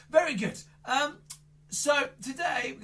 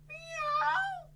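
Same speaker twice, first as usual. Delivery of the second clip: very high meow; cat meow. Shown as a demonstration. Magician giving kids a sound to guess, high cat meow. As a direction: mew like a cat. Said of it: "The animal goes".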